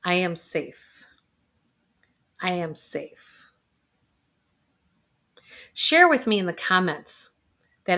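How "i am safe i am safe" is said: slow, soft, steady repetition; gentle guided affirmation; calm affirmation. Shown as a demonstration. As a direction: soothe yourself with each line. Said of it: "continue to say that to yourself".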